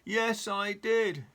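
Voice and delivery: slow loud shout; shouting at top of voice. Acted. Mister Noisy bellows back at the butcher. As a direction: bellow it slowly. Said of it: "shouted Mister Noisy at the very".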